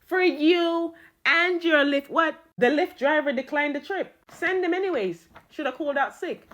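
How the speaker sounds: high, booming judge handing down punishment; theatrical judge's verdict; sentencing judge. Acted. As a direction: pronounce the sentence grandly, drawing out key words.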